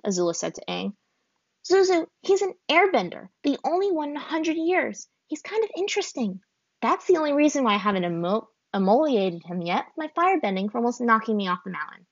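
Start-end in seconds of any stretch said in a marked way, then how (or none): 1.6-12.0 put-on much higher taunting girl's voice; teasing girl. A narrator's reading. Azula teases Zuzu about the airbender. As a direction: needle her brother.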